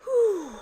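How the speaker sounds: high puffing exhale after stairs; breathless whew. Real, not acted. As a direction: blow out a winded breath. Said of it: "I still can't breathe yet".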